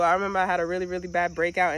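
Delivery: normal talking voice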